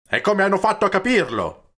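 loud, high-pitched incredulous question; exasperated outburst. A narrator's reading. Peppone pressing Don Camillo on how they understood, exasperated shout. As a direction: burst out, demanding an explanation.

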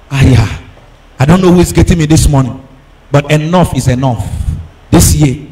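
ordinary talk

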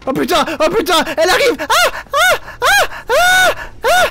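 very high rising screams; panicked scream. Real, not acted. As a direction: shriek as she comes. Enemy approaching, screaming.

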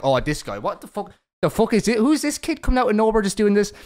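mock disbelief, pitched high; baffled onlookers. Host quotes viewers seeing his humour, high baffled voice.

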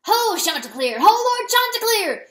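loud hail that climbs high on the second call. A narrator's reading. Weasel nearing the coop hails the rooster, calling out. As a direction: holler up to a lord from afar. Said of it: "he called as he neared".

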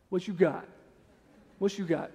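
low, soft taunt; understated challenge. Acted. Big friend standing up to bullies, quiet challenge. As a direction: quietly dare them to step up. Brian's presence silently challenging people.

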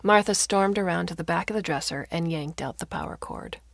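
usual speaking voice

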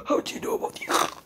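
strangled, rushed words after a gasp; choked. Acted. Orochimaru held by the throat, choking out a question. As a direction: squeeze it out as if being choked. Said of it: "I'm choking myself while doing this".

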